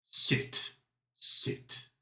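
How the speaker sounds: breathy whispered villain hiss; whispered command. Acted. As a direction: whisper it with menace.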